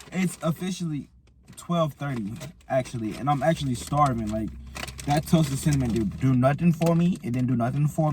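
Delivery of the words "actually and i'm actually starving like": usual speaking voice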